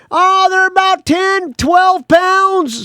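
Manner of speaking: put-on high, loud old sea captain voice; hammed-up salty skipper who can't hear; imitating the boat captain shouting back; weird old captain. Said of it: "He's like a weird old captain of a boat".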